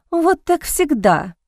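a touch of exasperated disappointment; upset. A narrator's reading. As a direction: lament it, drawing the words out. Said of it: "Леди Сент-Мор была расстроена".

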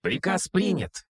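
high chirpy robot voice; squeaky droid voice. Acted. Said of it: "неуместным высоким голосом".

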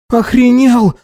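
high, indignant exclamation; breathed-out outrage. A narrator's reading. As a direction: blurt it high in disbelief. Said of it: "выдохнул он".

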